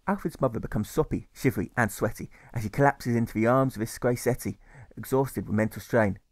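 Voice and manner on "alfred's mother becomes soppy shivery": ordinary delivery, nothing marked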